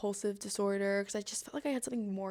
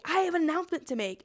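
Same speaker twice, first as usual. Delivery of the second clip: high, raised announcing voice; public announcement; 8 semitones higher, 4 dB louder, faster than usual. Retold or imitated. Volunteer standing up to address the dining hall, announcing. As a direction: imitate someone calling a room to attention. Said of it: "they stand up too and they say".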